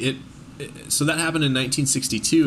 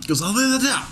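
fired-up investigator; 9 semitones higher than usual. Pretend. Playing a fired-up conspiracy investigator.

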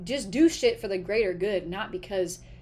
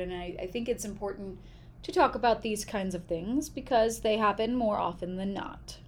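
normal talking voice

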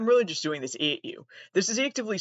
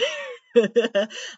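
bright burst of laughter; short loud laugh; real laugh; 6 semitones higher, 5 dB louder than usual. Real, not acted. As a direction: laugh at her own joke. Laughs at own enemy joke.

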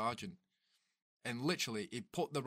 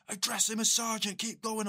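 high, loud barked command; retold shouted order; 11 semitones higher, 6 dB louder than usual. Sergeant barking at a struggling recruit during PT. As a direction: yell it like a drill sergeant.

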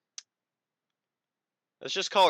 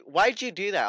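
higher, louder startled question; mock startled; 9 semitones higher, 8 dB louder than usual. Imitating Allie shocked at being sprayed. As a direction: yelp it in surprise. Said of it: "She was like".

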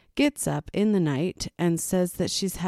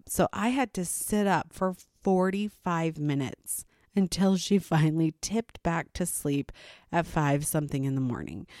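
usual speaking voice

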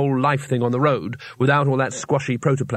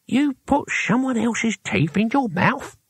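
put-on slow, high, lisping old man; incredulous question through bare gums; appalled old hero, drawn out; toothless astonishment; 9 semitones higher than usual. A narrator's reading. Cohen appalled and amazed, toothless.

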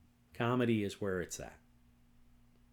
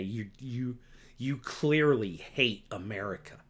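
usual speaking voice

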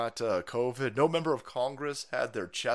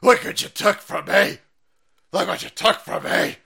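louder, higher mock cranky old man; old man yelling at clouds; 5 semitones higher, 9 dB louder than usual. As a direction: holler like a grumpy old man at the sky. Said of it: "like an old man yelling at clouds".

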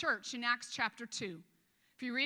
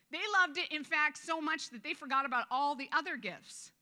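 ordinary talk

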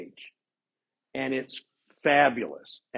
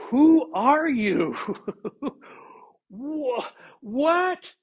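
stunned king; 10 semitones higher, much slower than usual. Acted. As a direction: blurt it out like a man who can't believe his eyes. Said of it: "I picture Lamoni".

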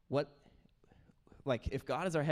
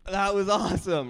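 loud, raised mock cheer; hearty cheer, higher and louder; 6 semitones higher, 7 dB louder than usual. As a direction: imitate an over-eager cheering parent. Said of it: "they just start cheering".

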